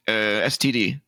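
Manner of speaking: usual speaking voice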